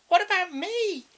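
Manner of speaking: high, small bat voice; tiny high voice; little voice. Acted. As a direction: squeak it from the back. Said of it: "a little voice from the back said".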